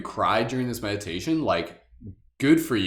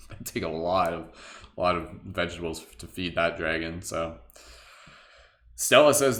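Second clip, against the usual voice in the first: ordinary delivery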